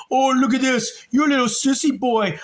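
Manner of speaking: imitating the bully mocking my little brother; mocking bully taunt. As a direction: taunt him like a playground bully. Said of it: "And he was saying".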